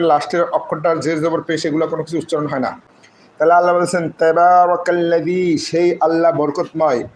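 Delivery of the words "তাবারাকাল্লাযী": Quran recitation. Acted. Teacher recites the verse.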